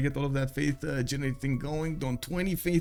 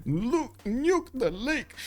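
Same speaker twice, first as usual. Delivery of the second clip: higher and very slow "nuke the lake"; joking suggestion; 10 semitones higher, much slower than usual. Real, not acted. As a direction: toss out a silly idea. Joking call in commentary.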